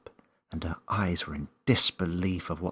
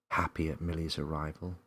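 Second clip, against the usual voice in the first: usual speaking voice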